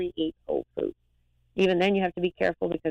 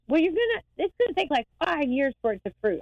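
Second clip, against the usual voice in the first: high, mock-skeptical sister voice; much higher voice as the skeptical sister; imitating sister; 9 semitones higher than usual. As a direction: imitate the doubting sister, pitched way up. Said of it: "my sister goes".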